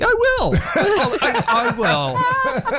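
high, laughing mock promise. Reassuring an old lady in the supermarket, laughing.

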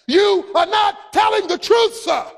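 a hint of Tom Cruise, high and slow; lightly voiced courtroom accusation; Tom Cruise line. Preacher quoting Tom Cruise to the killer, high and slow. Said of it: "Tom Cruise looks straight in the eye".

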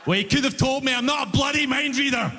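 high-pitched mock indignation; indignant retort. Passenger turns the clerk's own line back on him, indignant. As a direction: throw it back, high and affronted.

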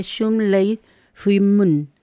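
"Shum lei fui mun" spelled out slowly syllable by syllable; slow careful pronunciation. Shown as a demonstration. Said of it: "pueden ver por el sonido". ("Shum lei fui mun" muy lento, sílaba por sílaba)

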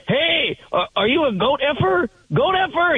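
high-pitched imitation of a screaming drunk; mock screaming. Drunk caller hears sheep baaing, mock high scream. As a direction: yelp it out like an outraged caller, without full volume. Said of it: "He starts screaming that".

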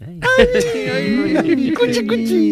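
very high cutesy coo; gushing baby talk. Pretend. Host parodying reaction to minister's tone, high baby talk. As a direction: coo over a baby in falsetto. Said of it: "un impulso irresistible de decir, "¡Ay, cuchi, cuchi!".

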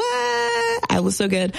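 high, slow sing-song brag; showing off. Pretend. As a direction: stretch it out way up high, preening. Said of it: "your girl's looking fleek right now".

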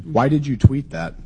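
ordinary talk

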